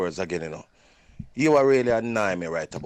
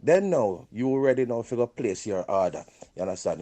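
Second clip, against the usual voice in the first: normal talking voice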